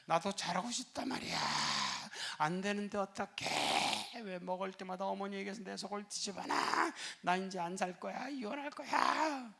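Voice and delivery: high, slow pleading wife; tearful plea. Retold or imitated. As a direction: plead through tears. 신부가 울며 호소 재연. (신부가 남편 품에 파고들며 높고 느리게 울며 호소하는)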